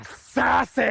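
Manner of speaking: hammed-up sass; sassy. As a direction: draw the word out with showy sass. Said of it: "This is the sassy way".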